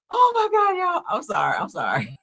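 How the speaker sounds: high outburst settling into a helpless apology; laughing to tears. Real, not acted. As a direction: recover from a real laughing fit. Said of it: "I'm over here tears laughing so hard".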